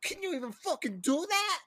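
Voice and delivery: very high, fast stunned whoa; stunned bro. Retold or imitated. Fan reacting to a movie shooting scene, very high and stunned. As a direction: gasp it out in disbelief, way up high. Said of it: "I was like".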